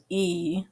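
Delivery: "E" said as its letter name; long e sound. Shown as a demonstration. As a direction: let "E" ring out as its own name. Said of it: "The long sound of E says its name".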